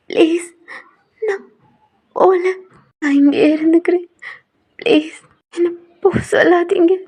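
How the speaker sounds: high, teary begging; scared new bride begging her mother-in-law, tearful and high; tearful pleading. Acted. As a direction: plead on the edge of tears.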